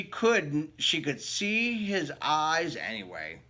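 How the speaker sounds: lively read-aloud storybook voice; story narration. Acted. Reading a picture book to children, lively storytelling voice. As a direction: read it like bedtime story time.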